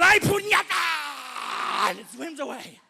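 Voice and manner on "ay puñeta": loud, higher, slow Puerto Rican curse; shark cursing in Puerto Rican Spanish. Acted. Punched shark curses, loud and slow in Puerto Rican Spanish. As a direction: bellow it like a stunned Boricua. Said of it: "And he goes".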